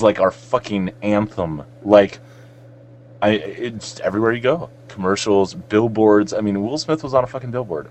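ordinary talk

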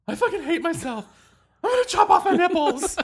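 high, loud, hammed-up whine; mock despair. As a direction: wail it like a drama queen. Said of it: "and I was like".

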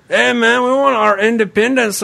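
put-on comic Mexican-accented character. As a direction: play a rebel in a mock Mexican accent. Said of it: "best accents are Mexican and French".

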